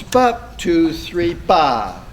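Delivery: punchy "bup", counted "two, three", then a falling "ba"; short note then rest. Shown as a demonstration. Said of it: "just an eighth note and then some rest".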